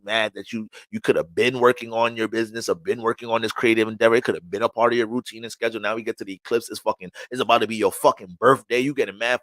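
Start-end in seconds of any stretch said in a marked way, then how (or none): none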